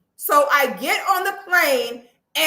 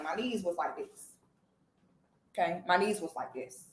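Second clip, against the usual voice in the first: softer, lower repeat of My knees was like this; quiet aside; 9 semitones lower, 11 dB quieter than usual. Real, not acted. Repeating to the audience My knees was like this, softer and lower. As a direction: drop to a soft, low murmur for My knees was like this.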